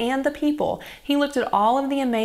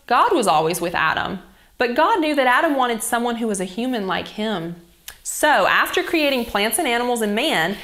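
ordinary delivery, nothing marked

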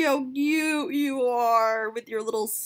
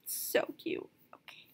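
whispering; 10 dB quieter than usual. Real, not acted. Whispered gush.

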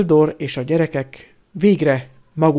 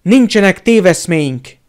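furious outburst, louder; Harry fumes at the minister; fuming; 5 semitones higher, 9 dB louder than usual. A narrator's reading. Fuming, loud outburst. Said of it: "tajtékzott Harry".

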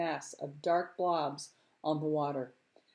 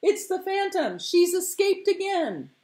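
high, loud chanting shout; crowd's cry; 12 semitones higher, 7 dB louder than usual. Acted. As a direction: echo the cry as a whole crowd would. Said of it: "The people took up the cry".

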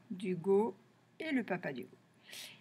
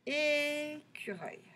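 Écureuil softer and higher, first É held long; drawn-out syllables; 5 semitones higher, 5 dB quieter, slower than usual. Shown as a demonstration. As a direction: slowly sound out Écureuil with a sustained É. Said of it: "on va chercher tous les mots".

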